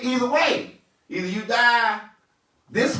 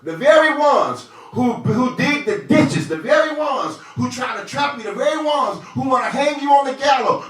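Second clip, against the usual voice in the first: a preacher driving the point home to the church; preaching; 3 semitones higher than usual. Real, not acted. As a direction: build the preach, hitting "very". Preaching at full force.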